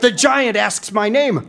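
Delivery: ordinary delivery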